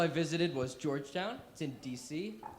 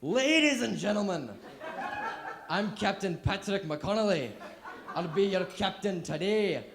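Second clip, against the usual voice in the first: loud, high Scottish captain over the intercom; Scottish pilot; 4 semitones higher, 6 dB louder than usual. Pretend. Scottish captain briefing passengers in a broad Scottish accent. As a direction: announce it like a cheery Scottish pilot, laughing. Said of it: "if he was like my good friend Seamus".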